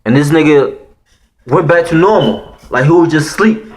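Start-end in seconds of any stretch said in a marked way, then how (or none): none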